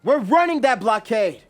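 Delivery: high, very loud command; rally the crew with a shout; forceful commander's yell; yelled order. A narrator's reading. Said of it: "He then yells".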